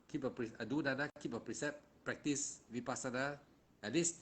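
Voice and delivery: ordinary talk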